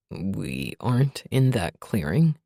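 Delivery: deep, slow, puzzled; groggy confusion, low; low male voice. A narrator's reading. Revan confused.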